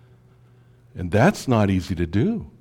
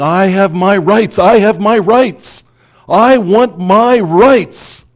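much higher, louder, slow mock demand for rights; entitled demanding; 9 semitones higher, 9 dB louder, much slower than usual. Pastor mimics people who shout for their rights, hammed-up demanding. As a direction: play a self-righteous crowd insisting on its rights. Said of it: "all these people that are saying".

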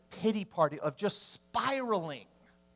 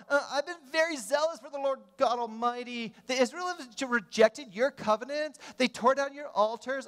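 put-on higher, whiny valley girl Elijah; 7 semitones higher than usual. Elijah whining to God like a valley girl. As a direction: whine it like a valley girl complaining. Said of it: "I turned into a valley girl".

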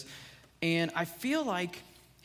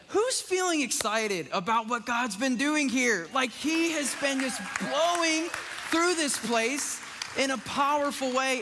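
raised, louder calling out to the room; excited rally; 6 semitones higher, 4 dB louder than usual. Real, not acted. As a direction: fire up the congregation. Pastor rallying congregation's excitement.

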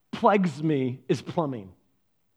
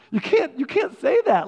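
high-pitched, half-laughing objection; comic exasperation at an absurd request; incredulous protest; 8 semitones higher, 4 dB louder than usual. Retold or imitated. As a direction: protest in amused disbelief, voice jumping up.

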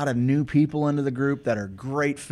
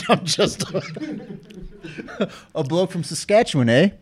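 put-on laughing, a light Canadian accent with eh; a bloke from Saskatchewan, hammed up; 7 semitones higher, slower than usual. Cohost joking, in a mock Canadian accent. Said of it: "step in as a Kiwi".

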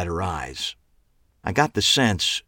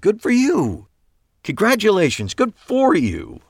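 much higher, louder forced cheer; over-eager congrats; 5 dB louder than usual. A narrator's reading. Eli congratulates Megan on her divorce, awkwardly gushing. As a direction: gush awkwardly, overdoing it.

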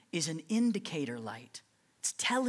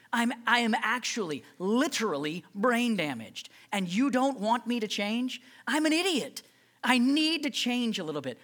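self-mocking outburst; 5 semitones higher, 5 dB louder than usual. Mocking his teenage self.

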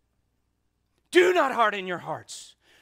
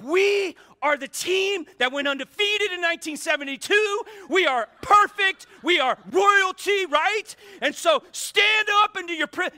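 higher, louder stadium rant; retold yelling; 9 semitones higher, 7 dB louder than usual. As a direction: shout it like a rowdy fan rallying the section. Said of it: "and I'm yelling".

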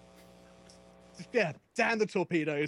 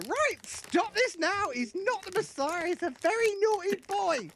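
stern mum voice; 14 semitones higher than usual. Retold or imitated. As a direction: scold like a Python mum. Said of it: "the mum to come along and just go".

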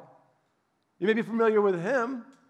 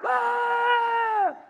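mock yell; 4 dB louder than usual. Mock rebel shouting at king.

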